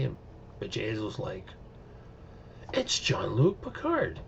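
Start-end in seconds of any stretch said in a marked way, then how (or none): 2.7-4.2 high, slow, drawn-out sing-song reveal; mocking announcement. Bjayzl outs Picard to the room, slow and high. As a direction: announce it slowly, savoring the reveal.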